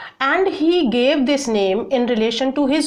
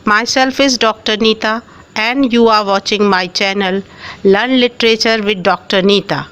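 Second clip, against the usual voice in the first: louder, upbeat YouTube host opening; channel intro; 6 dB louder than usual. Acted. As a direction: greet the viewers like a channel host. Loud channel intro greeting.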